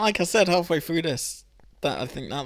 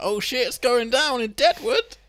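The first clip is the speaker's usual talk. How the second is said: higher, louder thrilled cry; excited exclaim; 9 semitones higher, 7 dB louder than usual. Retold or imitated. Host recalling his thrill at the show, higher and louder. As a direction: burst out with glee. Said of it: "I was like".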